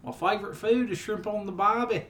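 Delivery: mock Australian. Continues fake Australian bit.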